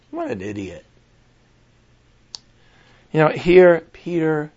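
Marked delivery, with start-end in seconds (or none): 0.0-0.9 mocking laugh. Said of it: "laughing at the Christians".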